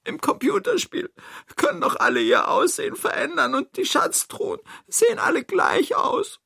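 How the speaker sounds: very high, tearful character voice; sniffling Toni. Acted. Toni explains why the thief is lost, high and sniffling. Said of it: "schnieft Toni".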